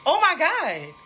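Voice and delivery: shocked exclamation. Retold or imitated. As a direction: re-enact a horrified discovery out loud. Acts out shock at messages.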